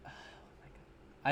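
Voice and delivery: breathy, whispered shock; hyperventilating panic. Acted. Acting out having a gun pointed at him, hyperventilating panic. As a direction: gasp it out in acted panic. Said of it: "I'd, like, be hyperventilating".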